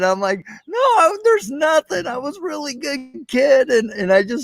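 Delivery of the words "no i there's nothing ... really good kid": put-on much higher wailing kid's protest, the first words shouted; a boy of eight bawling that he was good; retold sobbing protest to his dad; imitating a bawling child.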